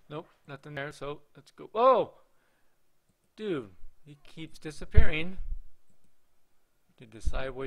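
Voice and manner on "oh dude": sudden high, loud "oh"; startled cry. Real, not acted. Player changing views in the game, startled. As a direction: jolt at what just appeared. Figure disappears on switching.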